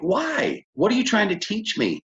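high-pitched, frustrated challenge; acted exasperation. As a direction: re-enact an impatient student demanding an answer.

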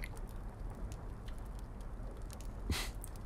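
easy breathy chuckle; gentle laugh; soft laugh. Acted. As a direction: laugh to lighten the mood. Laughs while cheering listener up.

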